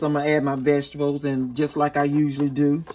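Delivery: ordinary talk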